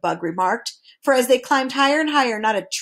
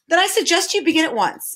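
admonishing; 7 semitones higher than usual. A narrator's reading. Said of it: "admonished the dodecahedron".

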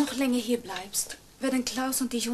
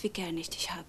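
much softer, lower and whispered; tender whisper; 6 semitones lower, 8 dB quieter than usual. Acted. Daughter-in-law tells mother she loves her, whispering tenderly. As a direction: say it close and gentle.

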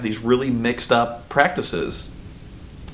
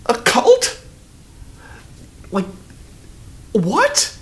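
high, incredulous squeak of disbelief; mock people's shocked confusion; baffled disbelief; 9 semitones higher, much slower than usual. Imitating puzzled moviegoers, high and incredulous. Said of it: "people are like".

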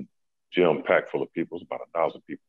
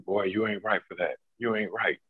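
higher, playful scolding; teasing parent voice; 6 semitones higher than usual. Retold or imitated. Parents teasing Kobe for his revenge, higher playful scold. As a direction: tease him like a parent who is laughing.

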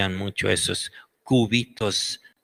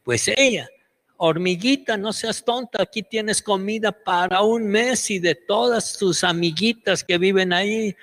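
much higher, sing-song coaxing voice; high coaxing scientist; 12 semitones higher than usual. Retold or imitated. The scientist coaxes the scared ant, high coaxing voice. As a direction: coax the little ant like a child. Científico le decía.

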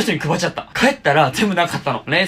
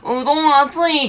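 put-on whiny girlfriend; 8 semitones higher than usual. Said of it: "って言うから".

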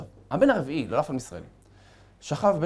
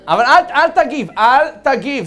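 high, loud, pressing don't react; loud insistent warning; 9 semitones higher, 10 dB louder than usual. Acted. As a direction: urge him hard to hold back. Warning the listener not to react.

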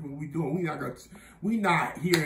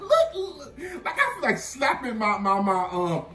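laughing self-mockery; 6 semitones higher than usual. Real, not acted. Laughing at own past self.

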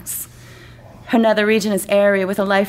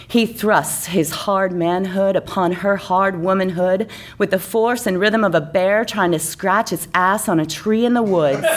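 plain reading voice

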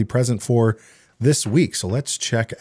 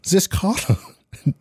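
fake German accent; 10 semitones higher than usual. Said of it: "I'm going into German for some reason".

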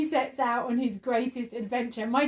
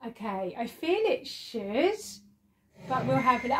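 usual speaking voice

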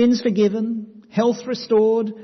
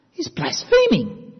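much higher, slow, scandalised; outraged scribe; 11 semitones higher than usual. Retold or imitated. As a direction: play the shocked teacher of the law. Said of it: "thinking to themselves".

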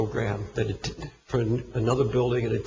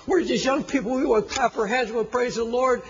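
much higher, pleading and near-crying youth pastor; impassioned plea; 10 semitones higher than usual. Retold or imitated. Youth pastor begging older folks' forgiveness, high and impassioned. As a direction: cry out the apology in a high, choked rush.

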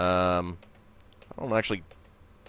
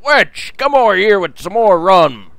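put-on loud, much higher drunken yell for the barmaid; rowdy seaman yelling; 8 semitones higher, 10 dB louder than usual. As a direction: bellow across the tavern for rum. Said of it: "One of the rowdy seamen yells".

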